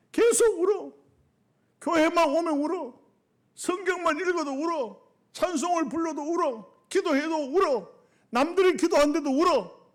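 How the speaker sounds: high, rhythmic pulpit list; insistent preaching. Real, not acted. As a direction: pile up each example with rising fervour. Preaches at full force.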